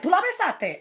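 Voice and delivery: sharp, high accusing question; confronting the girlfriend about the kiss, angry and high; furious confrontation. Retold or imitated. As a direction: demand it like you just caught her cheating. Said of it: "Walk up to her furious".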